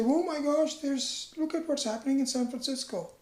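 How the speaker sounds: high, gushing mock excitement; mock excited. Teacher acting out a distracted chatterer, excited. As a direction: gush about the city news. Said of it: "you'll say".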